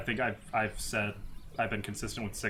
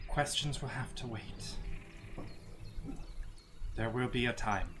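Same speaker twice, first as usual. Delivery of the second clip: put-on measured, grave old cleric; solemn priestess voice; 4 dB quieter than usual. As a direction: speak like a dignified holy woman handing over relics. GM as Ilya again.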